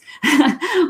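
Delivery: quick high chuckle; light laugh. Real, not acted. Reader reassuring viewers we're only human, light laugh. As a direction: laugh it off briefly. Amused at being human beings.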